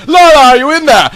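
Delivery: high, loud call through a door. Acted. Legolas calling through the cell door to find Lorna.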